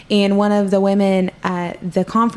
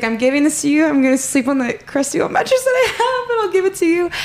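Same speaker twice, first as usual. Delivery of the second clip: tearful laugh; 8 semitones higher than usual. Real, not acted. Tearful surrender to God retold.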